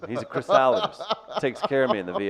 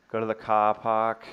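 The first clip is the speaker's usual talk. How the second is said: put-on lightly done Boston accent, dropping the r's; a quick jokey Boston accent, car park as cah pahk. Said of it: "you do your Boston accent".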